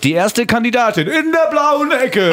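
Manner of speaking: lightly hammed ring announcer voice; a hint of fight-night hype; boxing announcer. Announces song like boxing bout.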